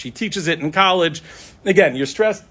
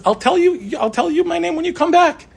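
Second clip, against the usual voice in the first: playful manager; 13 semitones higher, 3 dB louder than usual. Retold or imitated. Store manager's playful offer.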